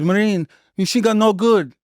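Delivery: quick hint of the Iraqi trainee's accent; Iraqi soldier's broken English. Quoting the Iraqi recruit, a mild Iraqi accent. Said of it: "He says".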